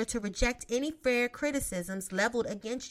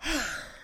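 heavy sigh. Real, not acted. After resigned remark.

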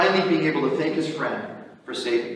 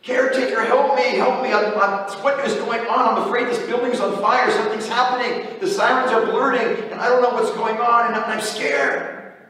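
high, frantic pleading, voice pitched way up; panicked cry for help; 7 semitones higher than usual. Retold or imitated. Missionary calling out to the caretaker at night, high panicked pleading. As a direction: beg the caretaker for help like the building's on fire. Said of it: "He cries for help".

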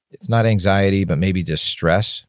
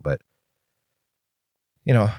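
usual speaking voice

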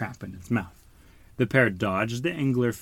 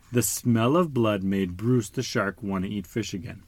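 plain reading voice

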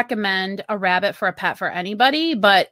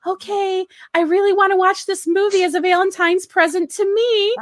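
pleading wife voice, pitched up; a wife coaxing her husband into a movie, sweetly higher; mock sweet request; 8 semitones higher than usual. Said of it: "tell our husbands".

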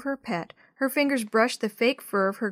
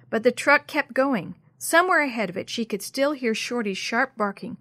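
ordinary delivery

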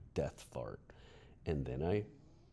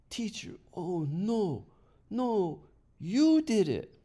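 put-on high, loud child's voice, slowing in shock; a boy catches his teacher out, shocked; incredulous accusation; 8 dB louder, slower than usual. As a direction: point and cry out in disbelief.

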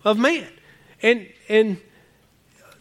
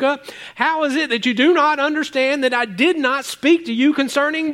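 forceful scripture reading; 7 semitones higher than usual. Acted. Pastor reads Jesus rebuking the disciples, proclaiming. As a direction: proclaim the verse, raised and ringing.

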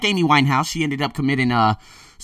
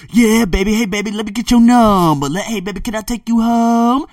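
mock catcalling pickup lines, higher and louder; hammed-up leering guy at a club; gawking men; 9 semitones higher, 6 dB louder than usual. Imitating men hitting on women in Vegas clubs, leering. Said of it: "all the men are, like, gawking at 'em, saying".

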